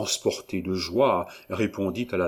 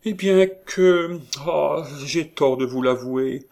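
high, slow, coy hesitation; prim woman feigning shyness; voix minaudière; 6 semitones higher, 4 dB louder than usual. A narrator's reading. Perrine coyly hesitates. (aveu hésitant et minaudier)

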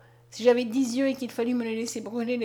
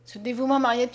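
ordinary delivery, nothing marked

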